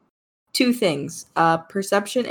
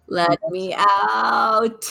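pleading howl; 2 semitones higher, 3 dB louder, much slower than usual. Acted. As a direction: wail to be let out, stretching every word. Cat begs to be let out.

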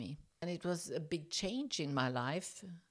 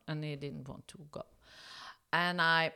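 ordinary talk

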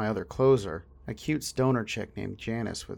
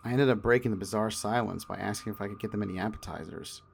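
ordinary delivery

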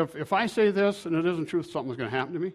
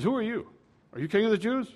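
lightly acted questioner, pitched higher; questioning Pilate; 6 semitones higher, faster than usual. Pilate questioning Jesus, lightly voiced inquiry. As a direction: raise the pitch slightly, a mild interrogation.

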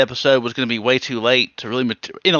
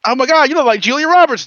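mock-starstruck, pitched up and louder; gushing fan; 10 semitones higher, 6 dB louder than usual. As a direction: play an excited fan spotting a star.

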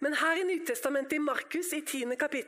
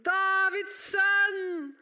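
long sustained holler; slow, stretched shout for attention; calling out; 2 semitones higher, 4 dB louder, much slower than usual. Acted. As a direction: cry out the name, holding each word. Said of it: "han ropte bare enda høyere".

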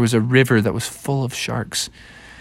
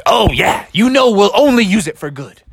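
higher, eager promise; eager assurance; 7 semitones higher, 3 dB louder than usual. Acted. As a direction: jump in with an over-keen yes. Eager reply to the grandfather.

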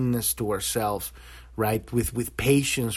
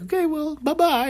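breezy dismissal; 12 semitones higher than usual. Retold or imitated. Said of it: "you go like".

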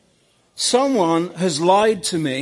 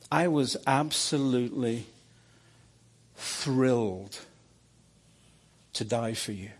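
tender reassurance; 3 semitones lower than usual. Retold or imitated. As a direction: comfort him like a loving father. Jesus gently reassuring him.

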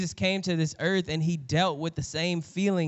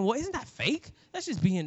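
sceptical objection; 8 semitones higher than usual. Acted. Voices a sceptical listener's objection.

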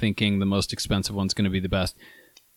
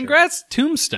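much higher, cheery sarcastic toast; mock congratulations. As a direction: raise a glass with a big high voice.

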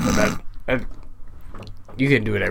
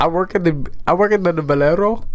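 higher-pitched mock Indian accent; Gandhi accent; 5 semitones higher than usual. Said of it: "like Gandhi".

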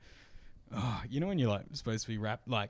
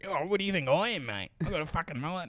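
a quick, higher, mock-indignant comeback; a touch of riled-up mate; lightly imitating Brett bristling; fired up; 6 semitones higher, faster than usual. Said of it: "kind of being fired up".

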